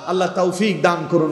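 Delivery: normal talking voice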